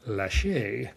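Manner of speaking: "lache" as la-SHAY, mock French; French accent. Host imitating a federal judge in Atlanta, "lache" with a French accent. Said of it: "with a French accent".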